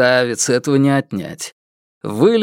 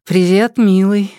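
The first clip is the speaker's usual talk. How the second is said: cooing; 5 semitones higher, 3 dB louder than usual. A narrator's reading. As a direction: purr the greeting sweetly. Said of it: "проворковала она".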